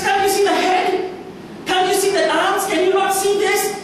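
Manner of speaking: high, urgent, insisting; high pleading artist voice; passionate pleading. Acted. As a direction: make them see the statue. Michelangelo pleading in story.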